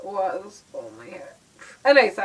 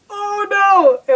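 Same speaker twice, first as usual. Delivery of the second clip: sudden high, loud yelp of alarm; retold panic; 10 semitones higher, 9 dB louder than usual. Telling viewers how she panicked when the call rang out, a loud high mock yelp. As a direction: squeal it like you just got caught out.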